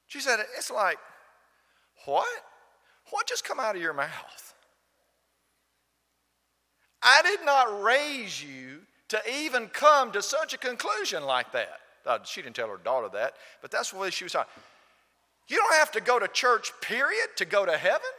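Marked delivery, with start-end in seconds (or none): 0.5-11.8 shocked mother. Retold or imitated. Mother's shocked retort to daughter. 15.5-18.1 incredulous echo. Retold or imitated. Preacher echoes the idea incredulously.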